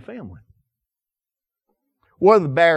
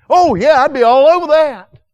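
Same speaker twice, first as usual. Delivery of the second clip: much higher and louder, gung-ho; eager yes; 12 semitones higher, 9 dB louder than usual. Acted. Preacher shows how eagerly he'd preach to a ready listener, excited. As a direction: jump at it like a kid offered candy. Eager reply to easy opportunity.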